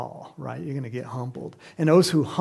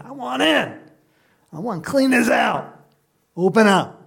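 louder, higher insistent call; insistent demand; 7 semitones higher, 7 dB louder than usual. Retold or imitated. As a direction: call through a door to be let in. Voices Christ knocking at hearts.

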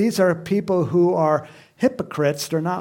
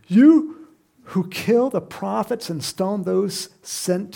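drawn-out, mournful quote; slow down and lament the city; a slow grieving cry over the city; 4 semitones higher, 4 dB louder, slower than usual. Acted. Quoting Jesus' lament, slowed.

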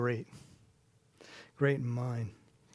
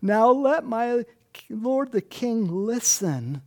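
formal plea; 7 semitones higher, slower than usual. Retold or imitated. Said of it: "what he's saying here".

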